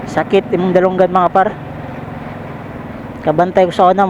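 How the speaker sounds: ordinary talk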